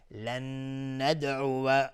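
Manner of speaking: "لن ندعو" slow, with a long hummed double n; merged nun with ghunnah. Shown as a demonstration. Teacher models the merging rule, "لن ندعو" with held nasal n. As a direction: hold the nasal n in "لن ندعو", ending on wa. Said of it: "نون ادغام با غنه".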